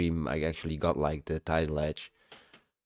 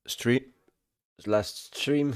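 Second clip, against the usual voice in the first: talking through hiccups; 7 semitones higher, much slower than usual. Real, not acted. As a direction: speak with hiccups breaking in. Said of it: "Sorry, I have the hiccups".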